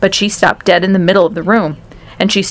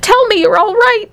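desperate cry. A narrator's reading. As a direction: beg for an answer, voice very high. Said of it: "cried Missus T V".

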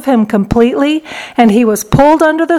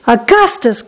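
ordinary delivery, nothing marked

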